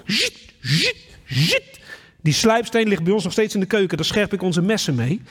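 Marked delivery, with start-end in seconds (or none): 0.1-1.8 scythe whetting sound. Retold or imitated. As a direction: imitate a whetstone on a scythe. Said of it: "ik hoor het nu nog, zo".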